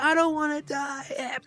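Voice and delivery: high, slow mock wail; sad retold plea. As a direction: imitate a doomed woman's drawn-out cry. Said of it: "it's so sad 'cause she's like".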